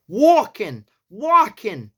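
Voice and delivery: "Walking" with a long rounded "or" vowel and a loud, high New York punch. Shown as a demonstration. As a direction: say "Walking" like "wor-king", New York style, with a strong push. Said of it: "very easy to say in a New York accent".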